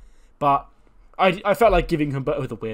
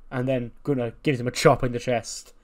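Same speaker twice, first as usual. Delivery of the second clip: usual speaking voice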